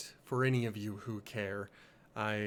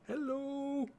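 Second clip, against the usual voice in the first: much higher, sing-song little hello; cute greeting. Pretend. Streamer greeting chat in a squeaky high hello. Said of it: "Say hello".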